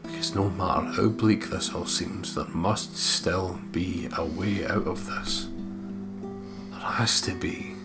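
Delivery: ordinary talk, nothing marked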